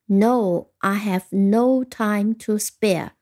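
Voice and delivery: slow, measured example reading; model answer. Shown as a demonstration. Teacher showing learners how to answer, slow model reading. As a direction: give the answer slowly, word by word. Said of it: "你可以这样回答".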